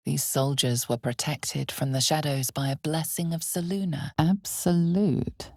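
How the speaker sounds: ordinary talk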